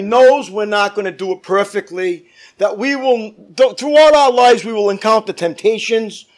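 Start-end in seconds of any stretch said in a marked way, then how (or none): none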